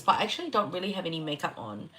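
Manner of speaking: ordinary talk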